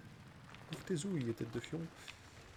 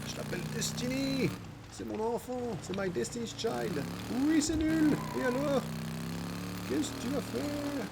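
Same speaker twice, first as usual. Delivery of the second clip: high silly voice; 9 semitones higher than usual. Pretend. High-pitched joking voice.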